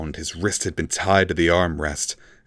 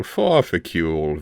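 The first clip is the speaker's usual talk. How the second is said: slow purring repeat of the name; purred. A narrator's reading. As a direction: purr it like a cat toying. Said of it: "Vilnix purred".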